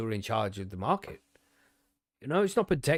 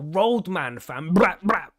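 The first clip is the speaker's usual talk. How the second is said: loud street slang, hammed up; mock roadman lingo, loud; roadman accent; 4 semitones higher, 7 dB louder, much slower than usual. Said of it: "they use all that fancy lingo".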